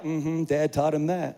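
slow, low, smug aside with a chuckle; a proud father chuckling to himself at his son's shots; smug proud dad. Retold or imitated. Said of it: "And I was just back there like".

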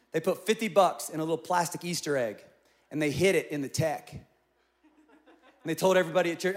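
ordinary delivery

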